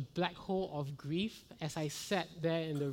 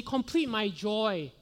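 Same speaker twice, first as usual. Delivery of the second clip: louder, higher, drawn-out emphatic quote; raised preaching voice on an imagined quote; emphatic rejected quote; 4 semitones higher, 6 dB louder, slower than usual. Retold or imitated. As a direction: proclaim it high and loud, stretching the words. Contrasts what Paul doesn't say.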